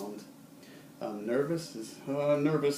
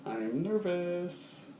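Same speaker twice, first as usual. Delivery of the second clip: higher, slow, hesitant "I am nervous"; 7 semitones higher, much slower than usual. Shown as a demonstration. Teacher showing students how to sound nervous, hesitant. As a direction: stretch out "nervous" with jitters. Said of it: "indicate that you're nervous".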